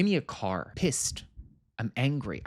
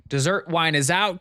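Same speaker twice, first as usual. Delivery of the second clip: louder and higher, calling it out; mock announcement; 5 semitones higher, 6 dB louder than usual. Recapping the toast war, mock announcer. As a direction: announce the wine is gone.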